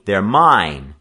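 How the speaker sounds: loud indignant claim; firm, indignant protest. A narrator's reading. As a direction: insist on ownership, affronted. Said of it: "Joe demanded indignantly".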